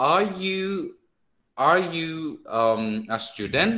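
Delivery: "Are you" held high, then "a student" rising. Shown as a demonstration. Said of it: "raise your voice and down".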